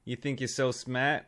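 a hammed-up Boston bar guy, lightly done; mock Boston tough guy taunting a smart kid; Boston accent. Podcast host mocking the Good Will Hunting bar scene in Boston accent. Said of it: "I was losing the Boston there".

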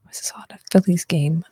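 whispered quick repeat; whispering. Real, not acted. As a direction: mutter the question to yourself. Repeating joke under breath.

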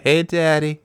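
a hint of a come-on call; catcall. Imitating a stranger propositioning a passerby, mock catcall.

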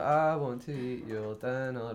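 slow, drawn-out mock band vocal; tamed song lyric. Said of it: "maybe if you say, like".

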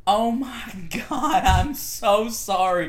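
high, loud, drawn-out apology; mortified apology. Retold or imitated. Mom apologises to teacher on phone, mock mortified. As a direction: imitate an embarrassed mom, hammed-up.

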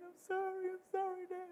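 put-on high, soft pleading child voice; imitated crying child. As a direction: plead like a tearful kid, high and small. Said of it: "and they're crying".

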